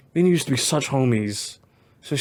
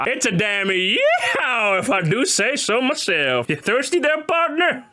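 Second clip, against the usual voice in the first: higher, slow mock Southern cowboy voice; Southern cowboy accent; 9 semitones higher, slower than usual. Teasing the listener as a partner, mock Southern accent. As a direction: tip your hat, mock yeehaw cowboy. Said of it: "I can't do the accent".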